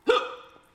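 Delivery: loud shocked gasp; sharp gasp. Real, not acted. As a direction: gasp in alarm. Reacting to her pain.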